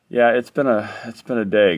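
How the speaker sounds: usual speaking voice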